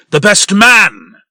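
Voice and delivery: high, loud and slow, sneering on "man"; bitter mockery. Posey sneering Snow's words back at her, loud and bitter.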